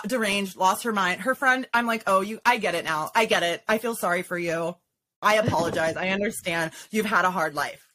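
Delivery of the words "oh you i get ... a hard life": condescendingly soothe, in a lowered voice; mock sympathy. Storyteller replaying fake pity for the belligerent woman, mock sympathy.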